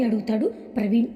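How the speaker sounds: ordinary delivery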